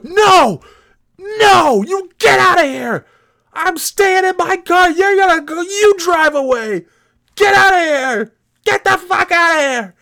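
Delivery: high, loud hammed-up screaming; mock screaming fit. Stranger in car shrieking at narrator to leave, mock frantic. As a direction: shriek him off, over the top.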